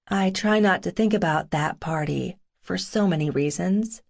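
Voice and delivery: usual speaking voice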